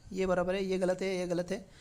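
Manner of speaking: ordinary talk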